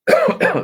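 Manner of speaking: sharp dusty cough; coughing on dust. Real, not acted. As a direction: hack out a cough. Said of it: "I've got dust in my throat here and I'm struggling".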